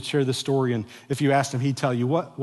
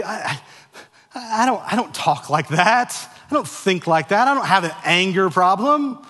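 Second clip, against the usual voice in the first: defensive denial; 6 semitones higher, 5 dB louder than usual. Retold or imitated. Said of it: "I wanna say".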